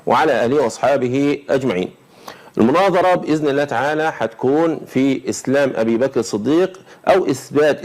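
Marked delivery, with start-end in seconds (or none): 0.1-1.9 slow, lowered devotional recitation; formal opening invocation. Acted. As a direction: recite the opening praise slowly and solemnly. Opening invocation before debate.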